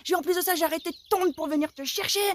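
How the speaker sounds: mother yelling down the phone at her son, a mild mock yell; retold yelling. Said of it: "je me mets à hurler dans le truc".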